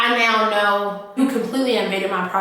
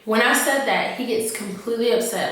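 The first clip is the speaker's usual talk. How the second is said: ordinary talk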